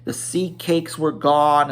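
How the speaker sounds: ordinary delivery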